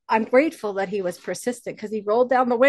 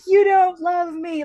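put-on higher, louder and slow like a wounded child; retold childish cry; 9 semitones higher, 6 dB louder, slower than usual. Little girl telling her grandfather he doesn't love her, mock crying. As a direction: re-enact a heartbroken little girl, lightly. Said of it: "And I started to cry".

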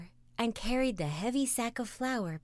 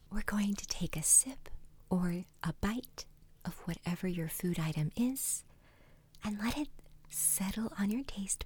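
ASMR whisper; 5 dB quieter than usual. Acted. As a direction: whisper gently as if guiding a meditation. Guided five senses exercise.

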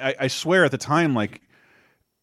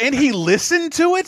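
high, louder mock shock; incredulous question; 13 semitones higher, 5 dB louder than usual. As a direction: play up the horrified disbelief. Retelling actor's horror at assistant.

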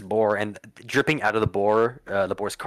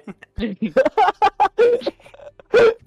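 loud, high-pitched laughing fit; real burst of laughter; 9 dB louder than usual. Real, not acted. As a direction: crack up loudly, voice leaping high. Cracking up at the reachy gag.

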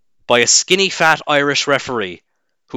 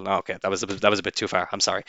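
drops much softer and lower, a real sheepish climb-down; sheepish quiet apology; 5 semitones lower, 9 dB quieter, faster than usual. Real, not acted. A ranting podcaster backs off his own joke to listeners, softly sheepish.

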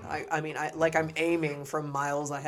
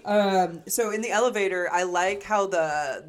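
ordinary talk, nothing marked

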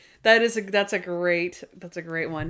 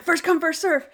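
high-pitched imitation of panicked blurting; mock freak-out; 8 semitones higher than usual. Retelling Randy freaking out with a guy on his lap, mock panic. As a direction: act out frantic, repeated blurting in a high voice. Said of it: "he starts freaking out".

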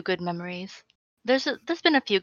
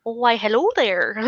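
attempted Scottish accent; 3 semitones higher, 4 dB louder than usual. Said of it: "do a Scottish accent".

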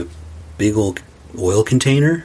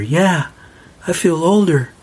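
put-on little boy; 7 semitones higher than usual. Eight-year-old answering his mother.

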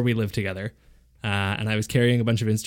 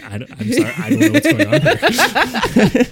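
high, slow self-imitation breaking into laughter; bewildered, laughing; 6 dB louder, slower than usual. Mimicking his own prank-show reaction, laughing bewilderment. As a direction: act baffled while cracking up.

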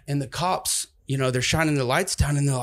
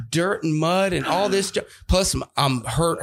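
usual speaking voice

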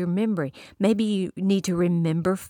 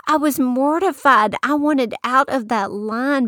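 higher and louder, mock mortified; excited; 5 semitones higher, 5 dB louder than usual. Real, not acted. Preacher telling her Starbucks blunder, louder and higher. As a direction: ham up the embarrassment.

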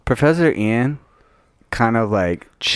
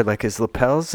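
ordinary talk

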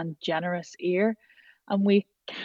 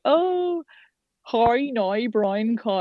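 put-on drawn-out, higher-pitched send-up of an Irish accent; mocking Irish accent; 4 semitones higher, much slower than usual. Manchester people taking the mickey out of an Irish newcomer's accent, mock Irish accent. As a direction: tease with an exaggerated Irish "how now brown cow". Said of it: "taking the mickey out of my accent".

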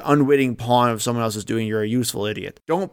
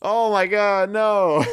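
shocked; 9 semitones higher, much slower than usual. Real, not acted. Shocked reaction to joke.

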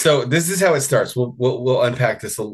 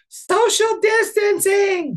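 much higher, slow mock kid's yell; child yelling; much slower than usual. Host rereads the article, voicing a boy scolding a child, mock high yell. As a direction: squeal it like a bossy four-year-old. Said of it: "he yelled at her".

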